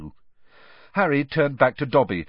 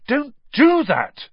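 startled shout; 14 semitones higher, 8 dB louder, slower than usual. A narrator's reading. Startled boy yelling at elf.

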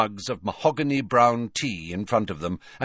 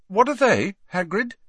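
high-pitched, forced interest; trying to sound interested; 12 semitones higher than usual. A narrator's reading. Harry asking Hagrid about maggots, forced interest. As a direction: feign curiosity over disgust. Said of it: "trying to sound interested".

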